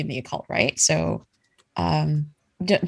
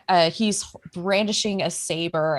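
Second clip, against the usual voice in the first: usual speaking voice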